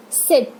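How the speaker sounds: सिट with a brief i; rapid short i. Shown as a demonstration. Teacher models the small i, सिट said short. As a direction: keep the vowel of सिट quick. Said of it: "इसको भी rapid pronounce करना है".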